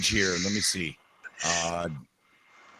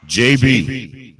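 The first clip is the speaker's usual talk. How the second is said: loud call at normal pitch; projected hail to a missing caller; 7 dB louder than usual. Real, not acted. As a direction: call the name firmly to raise them. Calling again for silent caller.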